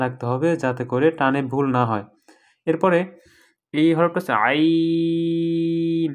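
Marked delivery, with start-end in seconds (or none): 3.7-6.1 আইন pressed in the throat, drawn slow; throaty Ain. Shown as a demonstration. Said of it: "গলার ভিতরে চাপাইয়া টানতে হবে".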